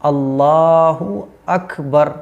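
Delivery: अल्लाहु अकबर very slow, every syllable clean; slow, clear Allahu Akbar. Shown as a demonstration. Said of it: "pronunciation क्या है?". (अल्लाहु अकबर बहुत धीरे और साफ़)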